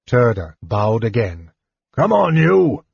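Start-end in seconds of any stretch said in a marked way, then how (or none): 1.9-2.9 higher, louder rough snarl. Acted. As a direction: snarl at the prisoner. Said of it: "he snarled".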